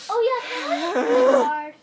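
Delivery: high, loud mock begging; mock entitled pleading. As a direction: ask loudly in a silly, high voice. Mocking an entitled nice guy.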